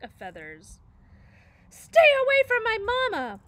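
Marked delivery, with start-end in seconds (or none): 1.7-3.4 very high, very loud protective cry; tiny gosling shrieking to protect his robot mama; gosling shout. A narrator's reading. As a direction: scream it as a fierce little gosling. Gosling swoops to defend.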